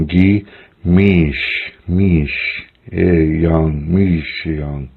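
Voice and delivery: careful teaching of "Gi mish yang", sh not z; correct pronunciation. Shown as a demonstration. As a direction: model "mish, yang" in separate pieces. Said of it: "I'm doing the same for you".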